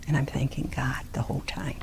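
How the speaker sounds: hushed confession; whispered aside. Real, not acted. Speaker telling the audience her private prayer, whispering. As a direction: say it under your breath like a secret. Secret aside about gratitude.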